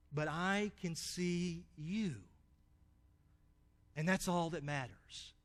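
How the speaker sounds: soft, slow fatherly comfort; quiet reassurance. Acted. Father softly reassuring his frightened son, slow and quiet. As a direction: soothe him gently, slowly.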